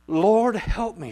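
loud, high, slow cry to the Lord; pleading cry. Acted. Preacher voicing the desperate woman, crying out. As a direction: cry out for help, drawn out. Woman's plea voiced in sermon.

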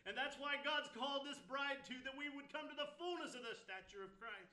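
climbing pulpit intensity; full-force preaching. Acted. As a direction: lift the congregation with rising fervour.

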